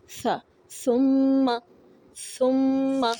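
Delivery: सुम्मा drawn out, held mm; slow demo. Shown as a demonstration. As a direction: stretch सुम्मा slowly. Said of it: "आज हमने पढ़ा सा". (सुम्मा धीरे और खींचकर)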